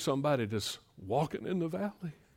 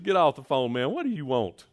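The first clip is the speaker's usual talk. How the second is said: louder, teasing get off the phone; mock bluster; 3 semitones higher, 7 dB louder than usual. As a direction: rib your buddy loudly.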